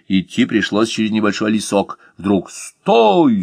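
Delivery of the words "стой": high, loud barked halt; thundering stop. A narrator's reading. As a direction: boom it out like a robber springing an ambush. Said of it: "загремело у него над ухом".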